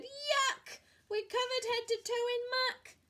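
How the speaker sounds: high, loud disgusted yell; disgusted thief. Acted. Hugh covered in muck, yelling in disgust.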